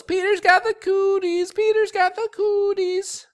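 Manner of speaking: put-on high sing-song girl's taunt; taunting yell. A narrator's reading. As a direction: chant it high and mocking like a pesky girl. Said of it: "she yells".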